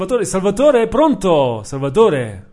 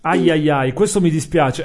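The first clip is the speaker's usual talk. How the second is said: normal talking voice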